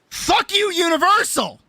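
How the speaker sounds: high cursing cry at the park; fed-up, high-pitched curse at Universal; real frustrated outcry. Real, not acted.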